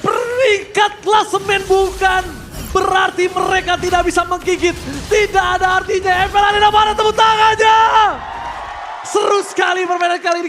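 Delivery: high, loud victory shout; fighting game announcer. Real, not acted. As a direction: roar the win to the crowd. Caster celebrates game win. (teriakan kemenangan caster)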